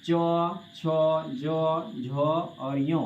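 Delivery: very slow, each syllable stretched out; teacher spelling sounds slowly; slow letter recital. Shown as a demonstration. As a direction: draw out छो, जो, झो and यो. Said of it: "दूसरा आ गया आपका चो".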